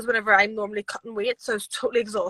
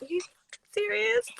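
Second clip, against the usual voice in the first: excited disbelief; 11 semitones higher, 3 dB quieter than usual. Retold or imitated. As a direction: pitch jumps high on serious, thrilled and stunned. Voice note reacting to big news.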